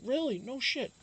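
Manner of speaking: high, amazed imitation of a friend; surprised disbelief. Friend learns their hometowns are close, high amazed reply. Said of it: "He's like".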